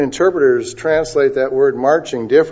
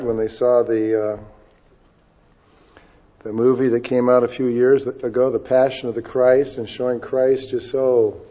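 ordinary talk, nothing marked

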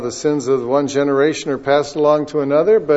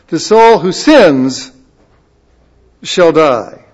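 louder scripture proclamation, slowing at the end; preaching at full force; 3 semitones higher, 5 dB louder than usual. Acted. Preacher reading Ezekiel's warning aloud to the congregation, forceful. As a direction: declare the Lord's word firmly. Reads God's words with force.

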